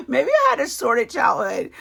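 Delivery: high laugh into a joking aside; a real laugh at her own odd remark. Real, not acted.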